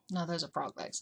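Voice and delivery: low, soft inner voice. Retold or imitated. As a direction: murmur it like a thought in your head. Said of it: "the little voice in my head".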